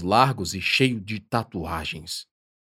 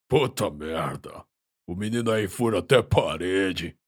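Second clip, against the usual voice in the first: a hint of a deep chesty giant; 2 semitones lower than usual. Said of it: "acrescenta com voz grave".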